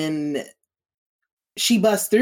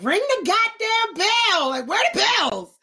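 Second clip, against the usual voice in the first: much higher, slow drawn-out demand; impatient yell. Retold or imitated. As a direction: yell at the screen, fed up. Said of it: "even I was like".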